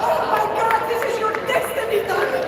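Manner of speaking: put-on high, thrilled accented exclamation; father's excitement. Proud father announces daughter's destiny, high and thrilled. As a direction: burst out with proud delight. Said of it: "And he said to me".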